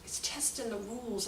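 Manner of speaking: soft, slow, drawn-out admiration; quietly savoring the mischief; approving relish. Retold or imitated. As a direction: say it slowly, like you secretly like it. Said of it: "I said it like that".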